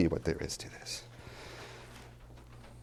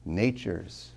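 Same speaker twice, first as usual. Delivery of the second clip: usual speaking voice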